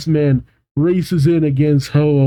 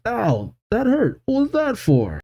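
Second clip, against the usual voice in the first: high, whiny mock yelp; pitched-up indignant whine; hurt protest; 9 semitones higher, 4 dB quieter than usual. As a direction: complain of pain in a soft, raised pitch. Gold pulled away by his ear.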